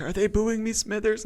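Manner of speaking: Mr. Burns. Retold or imitated. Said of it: "And Burns is like".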